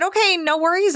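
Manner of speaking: bright, high, easygoing okay no worries; mock-cheerful reassurance to the bride; breezy okay. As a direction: brush it off lightly, pitch up on okay. Retold cheerful reply to bride.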